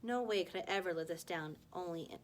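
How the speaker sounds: ordinary delivery, nothing marked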